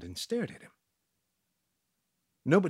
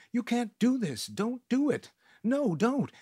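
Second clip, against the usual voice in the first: high, loud pleading yell; desperate yelling; 10 semitones higher, 3 dB louder than usual. Acted. Narrator pleading with the gang to spare the captive, yelling. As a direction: beg them to stop, frantic. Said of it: "started yelling".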